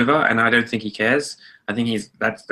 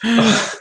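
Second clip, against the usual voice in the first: gasp; 12 semitones higher than usual. Real, not acted. Shocked by Transformers four remark.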